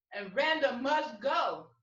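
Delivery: usual speaking voice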